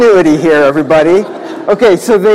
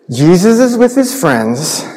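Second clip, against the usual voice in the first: ordinary talk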